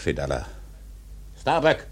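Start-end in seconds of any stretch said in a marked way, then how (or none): 1.4-1.9 sharp high call across the deck; captain barking a name. Acted. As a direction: summon the mate with one loud cry. Ahab calls out to Starbuck.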